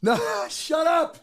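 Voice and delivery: high, slow laughing shout; high laughing shout at coworker. Real, not acted. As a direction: yell it while cracking up. Caked host laughs at teasing.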